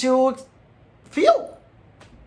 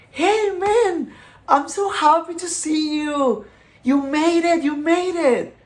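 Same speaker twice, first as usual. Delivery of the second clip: much higher, overjoyed welcoming voice; Jesus greeting him; 9 semitones higher than usual. Retold or imitated. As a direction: greet him like a long-lost friend, beaming. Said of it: "And he goes, like".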